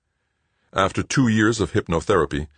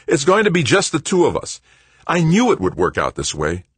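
excited, raised, louder voice; triumphant; 10 semitones higher, 5 dB louder than usual. A narrator's reading. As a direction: exult in having got your way. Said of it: "he said triumphantly".

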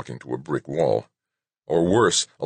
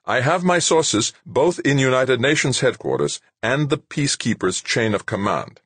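raised, tense executive voice; agitated Rashid; 9 semitones higher than usual. A narrator's reading. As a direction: insist on your sources.

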